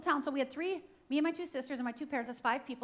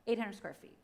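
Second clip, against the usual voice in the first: usual speaking voice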